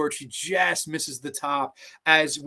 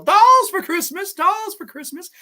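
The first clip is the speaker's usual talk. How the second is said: charity bell-ringer; 14 semitones higher, 6 dB louder than usual. Acted. Lady collecting dolls beside a barrel, high calling-out voice. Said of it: "She starts ringing the doll-, ringing the bell".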